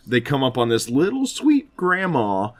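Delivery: normal talking voice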